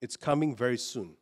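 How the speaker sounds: normal talking voice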